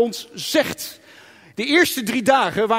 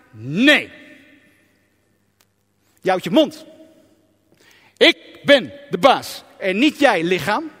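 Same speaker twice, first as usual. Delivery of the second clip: lower, firm voice, no arguing; stern command; 3 semitones lower, 4 dB louder than usual. Acted. Spirit sternly telling the body who's boss. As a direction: put the body in its place. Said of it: "zeg je tegen je lichaam".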